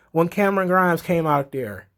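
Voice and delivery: ordinary talk, nothing marked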